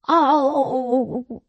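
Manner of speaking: high, pained groaning oh. Acted. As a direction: let the pain squeeze it out. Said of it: "groaned Mister Fledgeby".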